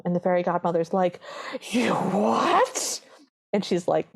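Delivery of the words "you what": drawn-up, incredulous question; mock shock. Fairy godmother reacting to the broken slipper, mock shock. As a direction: play up the disbelief, pitch climbing sharply.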